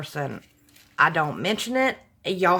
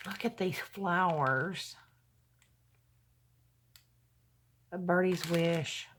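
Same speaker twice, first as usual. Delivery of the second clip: holding back tears; 2 semitones lower, 10 dB quieter than usual. Real, not acted. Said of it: "I'm trying not to get emotional".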